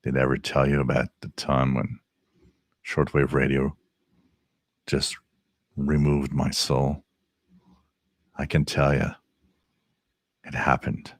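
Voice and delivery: mock-ominous ghost story voice, hushed; big spooky voice. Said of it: "I got the big voice for it".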